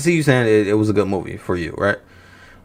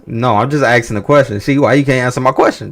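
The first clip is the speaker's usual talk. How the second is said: ordinary delivery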